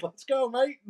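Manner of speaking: high-pitched mock Australian; attempted Australian accent. Imagining an Australian in a storm commercial, badly faked Australian accent. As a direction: have a rough go at an Australian accent. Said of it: "I can't do their accent".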